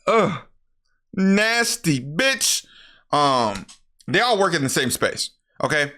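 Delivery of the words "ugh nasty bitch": slow, high, drawn-out disgust. Real, not acted. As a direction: stretch each word out in mock revulsion. Disgusted by speck on lip.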